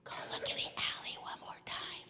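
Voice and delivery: breathy hushed whisper of an inner voice; soft whisper. Retold or imitated. Little inner voice whispering to the praying girl. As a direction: whisper it like a secret nudge. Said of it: "this little voice goes".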